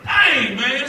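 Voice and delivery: loud, sudden exclamation; startled shout. Retold or imitated. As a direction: blurt it out, caught off guard. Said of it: "I'm like".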